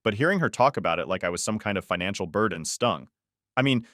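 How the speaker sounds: ordinary delivery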